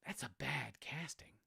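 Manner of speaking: hushed aside, whispered verdict; whispered mock verdict on casting. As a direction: lean in and whisper it like a secret. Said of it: "I'd be like".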